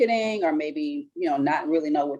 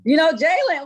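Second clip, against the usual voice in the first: surprised; 10 semitones higher, 6 dB louder than usual. Real, not acted. Surprised host learns guest knows daughter.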